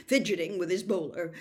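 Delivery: ordinary delivery, nothing marked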